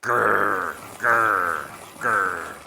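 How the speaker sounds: gritty mechanical growl; growly grinding noise. Acted. Sharpener grinding creature.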